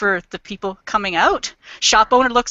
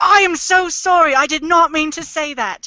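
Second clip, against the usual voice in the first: higher, emphatic mock apology; effusive apology; 4 semitones higher than usual. People apologizing for mistaking a Canadian for American, effusively apologetic. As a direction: gush an exaggerated sorry.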